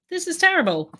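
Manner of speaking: mock critic. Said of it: "waiting for everyone to say".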